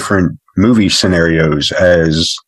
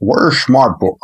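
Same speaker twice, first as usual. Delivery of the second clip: slushy Scottish actor impression, pitched up; higher put-on Scottish voice; Sean Connery; 4 semitones higher than usual. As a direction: slur the s's like a Scottish star. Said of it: "if Sean Connery had a stutter, he would be Jimmy Stewart".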